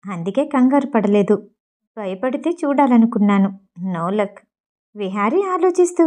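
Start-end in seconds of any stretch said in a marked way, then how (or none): none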